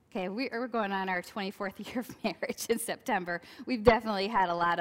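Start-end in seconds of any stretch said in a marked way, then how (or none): none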